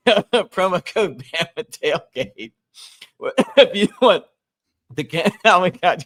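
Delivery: words breaking up with laughter, drawn out slowly; can barely get the ad out for laughing; laughing through the promo. Real, not acted. Host giggling through the Bama Tailgate promo read, laughing.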